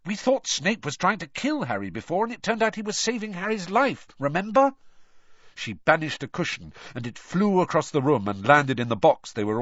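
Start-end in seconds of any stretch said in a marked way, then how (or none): 0.1-4.7 high-pitched, doubting reminder; skeptical. A narrator's reading. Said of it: "shaking her head skeptically".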